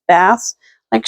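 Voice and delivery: ordinary talk, nothing marked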